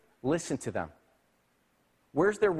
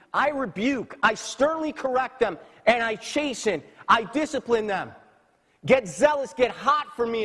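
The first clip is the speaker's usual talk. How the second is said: higher, louder divine rebuke, rising in force; stern rebuke; 6 semitones higher, 4 dB louder than usual. Acted. Preacher voicing God rebuking the lukewarm church, stern and loud. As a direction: thunder God's correction at the church.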